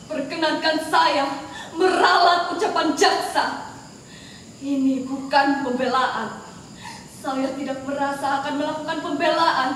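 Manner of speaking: ordinary talk, nothing marked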